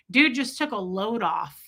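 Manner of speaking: normal talking voice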